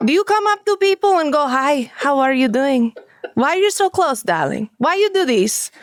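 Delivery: theatrical Russian lady, scolding with fake sweetness; Russian accent scolding. Haunt actor in Russian character chiding a guest, Russian accent. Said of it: "in the Russian".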